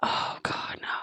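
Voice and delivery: whispered, aghast; horrified whisper. Retold or imitated. Watching a kid wave from the bench at a wedding, whispered horror. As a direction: breathe it out in horror. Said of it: "I was like".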